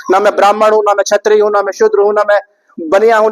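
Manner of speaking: normal talking voice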